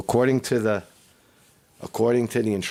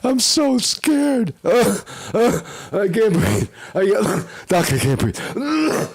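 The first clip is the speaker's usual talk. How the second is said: hammed-up panicked patient; 13 semitones higher, slower than usual. Man mocking patients who panic at the doctor, high whiny whimper. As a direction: overact a terrified patient gasping. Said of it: "You go in there like that".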